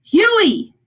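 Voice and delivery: loud, high-pitched shout; calling out. Acted. Father searches the rooms for Huey, calling out loudly. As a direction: holler the name through the house. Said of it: "he called".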